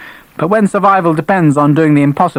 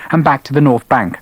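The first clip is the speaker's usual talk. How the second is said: ordinary delivery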